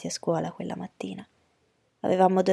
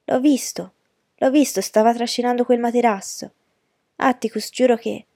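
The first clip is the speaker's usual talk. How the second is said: higher, louder, urgent pleading; agitated boy insisting; 4 semitones higher, 6 dB louder than usual. A narrator's reading. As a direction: insist breathlessly that you must be believed. Ragazzo insiste agitato col padre. (ragazzo agitato che insiste, voce alta)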